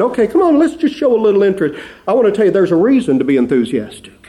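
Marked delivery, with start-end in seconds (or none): 0.0-1.8 much higher, fast cheerleader push; hammed-up pep rally call; mock cheerleading. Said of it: "I'm not just saying".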